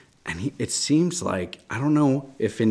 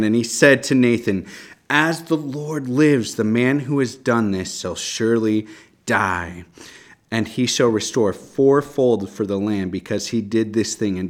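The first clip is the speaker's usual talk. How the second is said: scripture reading; 3 semitones higher, 7 dB louder than usual. Acted. Reads David's angry reply.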